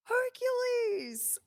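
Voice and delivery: high, drawn-out call of Hercules; excited call. Retold or imitated. The prince spotting her before going live, calling out high and slow. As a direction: call her nickname out with delight.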